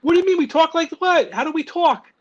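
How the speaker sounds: put-on high, loud, indignant Jersey accent; New Jersey accent. Imitating his family answering back, New Jersey accent. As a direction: mimic affronted relatives in a Jersey accent. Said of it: "I've never noticed you guys talk like this".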